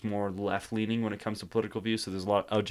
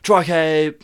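high, loud British self-introduction; hammed-up British accent, pitched up; Draco Malfoy; 7 semitones higher, 10 dB louder than usual. Shown as a demonstration. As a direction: announce the name in mock British. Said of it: "every time he talks".

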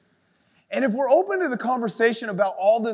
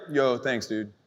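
too casual thanks; 8 semitones lower, slower than usual. Pretend. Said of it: "I don't want it just to be like".

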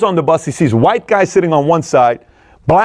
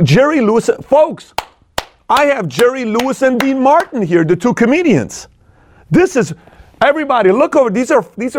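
mock showman; 8 semitones higher than usual. Mock introducing famous comedians.

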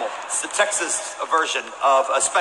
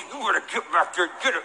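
much softer, hissed through clenched teeth; 5 dB quieter, faster than usual. Retold or imitated. Pastor imitating his mother threatening through clenched teeth. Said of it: "could say things through clenched teeth".

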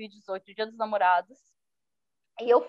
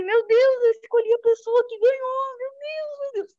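retold astonishment; 10 semitones higher than usual. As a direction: relive the shock of the winner being her. Retold shock at the winner. (espanto relembrado, agudo, sumindo no fim)

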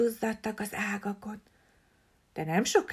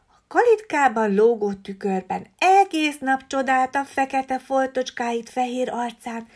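usual speaking voice

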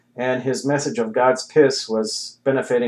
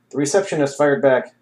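ordinary delivery

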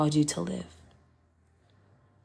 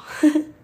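nervous laugh; 10 semitones higher, 5 dB louder than usual. Real, not acted. Laughs off rising emotion.

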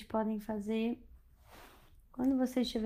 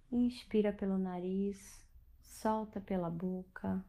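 guided meditation; 5 dB quieter than usual. Acted. Leads a bedtime meditation.

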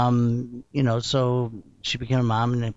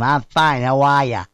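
put-on Boston accent; 5 dB louder than usual. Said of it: "he talks just like that".

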